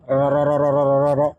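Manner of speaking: "ro" rattled off over and over, low; machine-like repeat of RO; stuttered R. Shown as a demonstration. Teacher mocking too many Rs, ro repeated rapidly. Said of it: "rohnya ada banyak banget".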